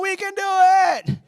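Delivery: high, loud, slow hopeful shout; hopeful fan cheer. Retold or imitated. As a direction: cheer from the stands at halftime. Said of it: "fan in the stands going".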